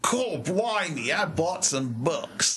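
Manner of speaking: exaggerated Australian twang; Australian accent. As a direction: ham up a slow Aussie accent. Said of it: "read the other ones in an Australian accent".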